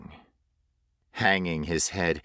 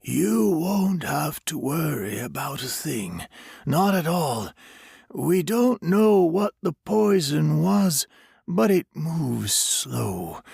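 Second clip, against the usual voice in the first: higher stranger voice fading to a whisper; eerie soothing stranger; 7 semitones higher than usual. A narrator's reading. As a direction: comfort him with dreadful news. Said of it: "the stranger said soothingly".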